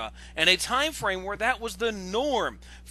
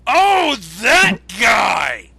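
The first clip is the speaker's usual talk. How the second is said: loud, very slow, drawn-out outburst; loud shocked recognition; 10 dB louder, much slower than usual. Real, not acted. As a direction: yell it, dragging the words out. Recognising the critic being discussed.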